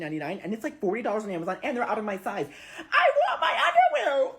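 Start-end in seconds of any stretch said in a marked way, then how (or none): 2.8-4.3 much higher, louder laughing wail; whiny outraged shout. Real, not acted. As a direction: throw a laughing tantrum. Ranting about stolen underwear.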